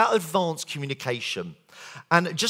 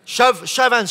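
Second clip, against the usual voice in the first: loud, high call for hands; urgent loud call for hands; 8 semitones higher, 9 dB louder than usual. Real, not acted. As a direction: rouse the room to raise hands. Calls out to audience again.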